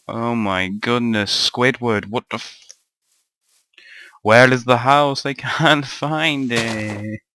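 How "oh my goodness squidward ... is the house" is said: ordinary talk, nothing marked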